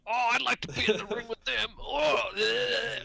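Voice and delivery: put-on high, loud, lecherous old-man leer; leering Jerry Lawler impression. Mocking Jerry Lawler's creepy ringside commentary, high lecherous leer. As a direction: ham up a creepy announcer ogling the women. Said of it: "for Jerry Lawler to be like".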